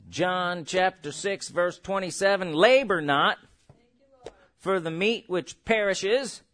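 slow, low solemn reading of scripture. Acted. Preacher reads John six to the church, slow and solemn. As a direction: read the verse slowly and gravely.

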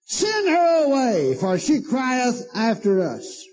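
high, slow commanding demand; bossy disciples. Retold or imitated. Disciples telling Jesus to send the woman away, high and slow. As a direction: order it high and slow, brushing her off. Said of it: "besought him, saying".

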